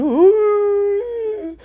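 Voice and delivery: very high, loud drawn-out calling cry; mock elephant call. Girl pretending to summon an elephant, high calling cry. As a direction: call the elephant over the plains.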